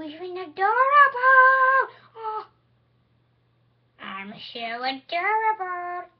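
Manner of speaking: gushing adorable squeal; squealing cute toy voice. Pretend. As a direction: squeal it like a toy delighted with itself.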